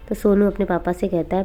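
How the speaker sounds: usual speaking voice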